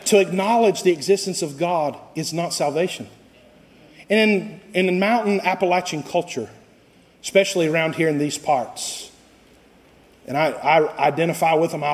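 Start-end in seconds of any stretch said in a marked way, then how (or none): none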